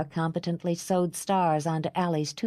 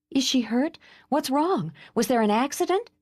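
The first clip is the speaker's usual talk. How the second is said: urgent, higher and pitched up with worry; alarmed; 8 semitones higher than usual. Acted. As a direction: fire off the questions in alarm. Sister alarmed by phone call.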